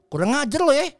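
put-on Jesus statue scolding the boy. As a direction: rebuke him like an indignant god.

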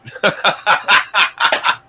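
bursting into laughter at his own joke; just crack up; breathy burst of giggling; real laugh. Real, not acted.

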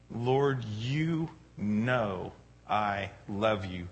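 very slow, softened acted plea; earnest pleading. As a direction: draw it out as Peter's heartfelt answer. Peter's earnest answer to Jesus.

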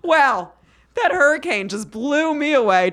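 chirpy parody of an on-air segue; mock news anchor. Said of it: "love to be a news anchor".

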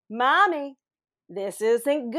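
put-on child voice. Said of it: "and she goes".

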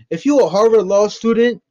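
disbelieving jab at the claim; scornful retort. Real, not acted. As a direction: call out the bluff, loud and high. Mocking Karen's claim.

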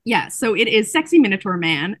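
ordinary talk, nothing marked

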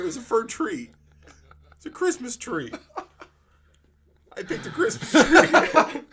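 high, loud, breaking into laughter; incredulous laughing. Real, not acted. Teller revealing the gift was a tree, laughing. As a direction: build from disbelief to helpless laughing.

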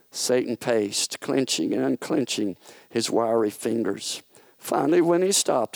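ordinary delivery

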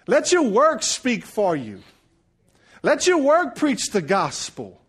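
emphatic, driving sermon delivery; preaching. Acted. Preacher urging church to let their work speak, preaching. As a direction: preach it out with punched key words.

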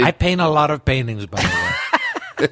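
boastful Trump-style riff. Pretend. Trump impression continued.

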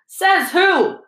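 high, sharp retort; loud challenge. A narrator's reading. Dean Thomas challenging Lockhart, loud and high. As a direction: call out in open disbelief. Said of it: "said Dean Thomas loudly".

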